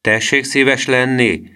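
drawn-out cheeky reply; taunting politeness. A narrator's reading. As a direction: invite him with slow, teasing courtesy. Boy taunts the threatening man.

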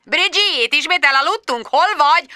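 high-pitched, impatient phone scolding; snorting boss. A narrator's reading. Boss asking where his sleepy employee is, high snorting impatience. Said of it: "horkantott Richard Finch".

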